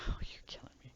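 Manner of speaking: whispered playful despair. Real, not acted. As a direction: breathe it out in mock pain.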